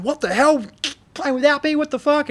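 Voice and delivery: high, outraged mock singer; indignant singer. Singer catching the band playing without him, outraged. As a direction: play the furious singer catching them out.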